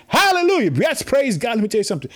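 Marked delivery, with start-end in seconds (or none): 0.1-0.7 high, loud hallelujah shout. Real, not acted. Preacher leading the congregation, shouting high and loud. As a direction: lift it up and shout. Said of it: "Can you shout it with me".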